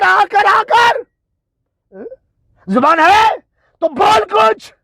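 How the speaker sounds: high, shouted volley of insults; angry taunting. Real, not acted. Goading silent opponents to speak, angry taunting shout. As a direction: hurl each phrase as a high, stretched taunt.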